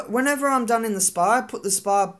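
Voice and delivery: usual speaking voice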